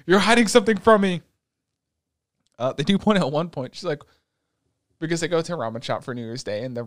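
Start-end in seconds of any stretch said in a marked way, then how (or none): 0.0-1.2 loud, high, hammed-up outrage; mock angry accusation. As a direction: fire off the accusation in mock fury. Acts out Igarashi's angry accusation.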